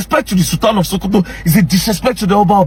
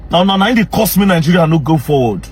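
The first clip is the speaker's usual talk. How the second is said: normal talking voice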